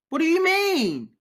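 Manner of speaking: high, loud, drawn-out disbelief; exasperated outburst. Real, not acted. Ranting at people paying millions for doggy pictures, exasperated. Outraged rant about NFT buyers.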